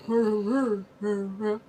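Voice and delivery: low rumbling wordless growl; mock gruff rumble; deep movie-voice mumble. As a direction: imitate a dark, deep Santa murmuring. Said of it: "has a deep, low voice".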